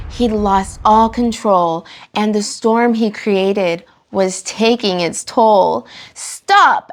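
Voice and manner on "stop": sharp mock yell of stop; a story yell, not full force. A narrator's reading. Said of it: "Albie yelled".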